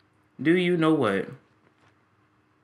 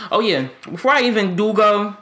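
ordinary talk

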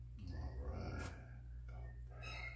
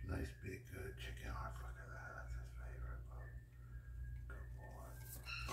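soft hushed whisper to the pet; whispering so the lizard stays calm; 18 dB quieter than usual. Real, not acted. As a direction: breathe the words, barely voiced.

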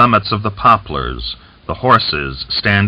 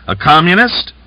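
sharp, high, louder question; curt question; 11 semitones higher, 5 dB louder than usual. A narrator's reading. Fomin questions a prisoner about being a communist, curtly. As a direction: fire it off curtly, pitched high. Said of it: "curtly asked".

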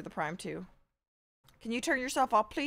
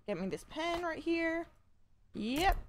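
ordinary talk

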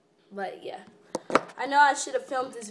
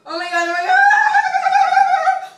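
high, slow panicked scream; freaking out; 12 dB louder, much slower than usual. Real, not acted. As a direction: shriek in real panic. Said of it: "I'm freaking out".

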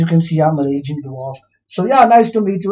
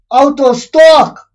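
angry outburst; 6 semitones higher, 4 dB louder than usual. Real, not acted. Gamer yelling at an in-game shop, angry. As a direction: blow up at the shop, high and loud. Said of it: "I was just angry".

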